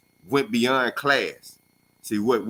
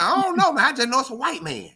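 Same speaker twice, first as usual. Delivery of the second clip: much higher, puzzled put-on voice; friend answering dad; 13 semitones higher than usual. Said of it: "He said".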